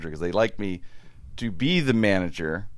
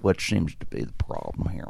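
a hint of a stiff, softer manager; fake manager voice; 3 semitones lower, 7 dB quieter than usual. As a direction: play a made-up manager lightly, a little softer. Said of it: "could actually do characters and voices".

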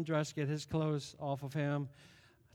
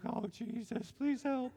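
soft, high, pleading prayer under breath; desperate prayer; 5 semitones higher, 6 dB quieter, slower than usual. Retold or imitated. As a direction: beg quietly for help. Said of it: "And I'm like".

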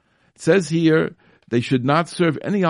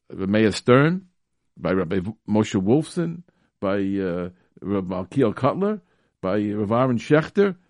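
ordinary talk, nothing marked

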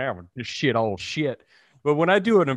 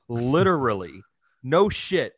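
ordinary talk, nothing marked

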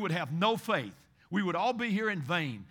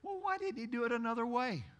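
skeptical listener; 2 semitones higher, 4 dB quieter, faster than usual. Retold or imitated. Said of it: "I know what you're saying".

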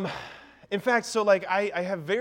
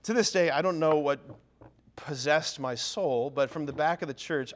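ordinary delivery

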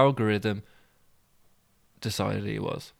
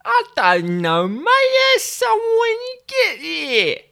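put-on much higher, slow London boy's voice; high-pitched young boy; 7 dB louder, slower than usual. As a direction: play a cheeky thirteen-year-old. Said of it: "therefore high-pitched voice".

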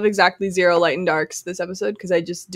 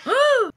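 sharp high gasp. Real, not acted. Excited lead-in to story.

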